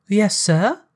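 put-on high, rising servant girl's voice; maid. A narrator's reading. Girl answering Scrooge at the door, politely.

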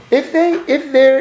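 normal talking voice

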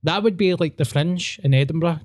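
normal talking voice

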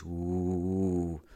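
long drawn-out "ooh" from the fans; sustained, level crowd oooh; imitated crowd ooh. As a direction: mimic a crowd's hum of building tension. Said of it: "all I could hear was from the fans".

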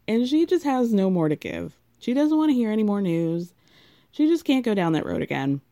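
ordinary delivery

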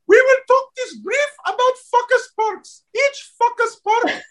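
high-pitched imitation, "focus" coming out as "fuckus"; mispronounced "focus". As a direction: imitate her saying "focus" with a short, unrounded "fuckus" vowel. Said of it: "you need to say focus a little more round".

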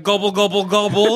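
loud mock turkey gobbling; turkey gobble. Turkey henchman bursting out.

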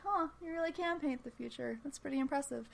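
high, soft, amused villain; chill, amused menace. Retold or imitated. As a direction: toy with him, pleased and in no hurry. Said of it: "in that chill ... kind of way that he does".

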